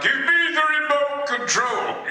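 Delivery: hammed-up villain line. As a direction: bark it like a pompous movie villain. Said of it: "I was saying things like".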